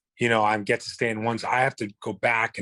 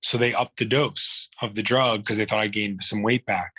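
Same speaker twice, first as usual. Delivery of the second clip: ordinary talk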